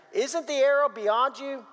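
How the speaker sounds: mild drawn-out call. A narrator's reading. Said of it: "Jonathan called out after him".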